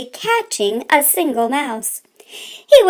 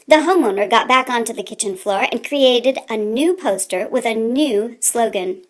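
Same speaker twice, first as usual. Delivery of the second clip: usual speaking voice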